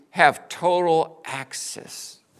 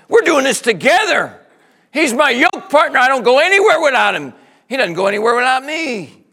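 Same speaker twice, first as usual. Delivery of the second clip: loud, higher, fast fervent preaching; preaching at full force; 5 semitones higher, 7 dB louder than usual. Real, not acted. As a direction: preach it with rising fire.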